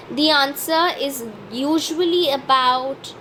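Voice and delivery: ordinary talk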